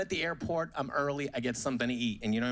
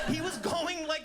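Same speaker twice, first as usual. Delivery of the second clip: ordinary talk, nothing marked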